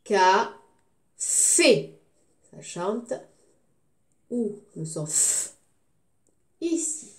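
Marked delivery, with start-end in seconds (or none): 0.0-1.9 loud CA, pause, loud FÉ; teacher sounding out "Café" slowly in pieces; syllable by syllable. Shown as a demonstration. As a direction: split "Café" into two separate loud beats. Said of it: "je découpe le mot".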